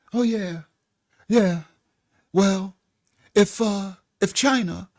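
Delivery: lightly voiced imitation of a laid-back, unbothered leader; mock calm world leader. Vlogger mocking the orange dude's threats, lightly voiced calm imitation. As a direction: loosely mimic him, casual and easy. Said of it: "let me try to mock him".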